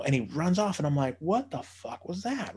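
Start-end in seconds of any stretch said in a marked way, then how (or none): 1.2-2.5 baffled. Retold or imitated. Boy runs off.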